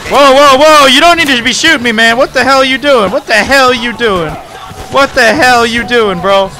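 loud, fast outraged yell; angry yelling. Real, not acted. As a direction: chew out the teammate. Teammate shooting at him.

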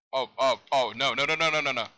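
loud string of quick repeated cries; real gamer panic; panicked reaction. Real, not acted. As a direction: blurt it out as disaster looms. Panicking as game goes wrong.

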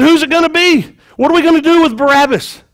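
high-pitched appeal to a crowd; calling out. Acted. Pilate asks the crowd to choose, calling out high.

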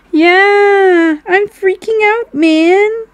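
high, loud, drawn-out movie quote; hammed-up freak-out. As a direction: play up the panic in a high, loud voice. Quoting a film line, put on.

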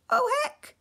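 high, loud panicked shark; frightened, high-pitched shriek into protest; wailing. A narrator's reading. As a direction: wail it high in alarm. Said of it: "the shark wailed".

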